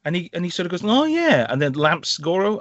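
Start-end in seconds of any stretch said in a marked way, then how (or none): 0.8-1.5 high, hammed-up dawning realisation; pitched-up mock eureka; mock sudden realisation. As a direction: imitate a hero suddenly catching on. Mimics fighter's sudden realisation.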